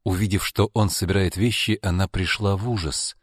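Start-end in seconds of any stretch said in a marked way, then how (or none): none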